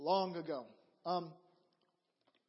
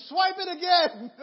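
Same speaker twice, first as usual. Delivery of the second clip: high, loud mock insistence; insistent demand; 7 semitones higher, 8 dB louder than usual. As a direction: demand it loudly, half joking. Insisting to the cashier.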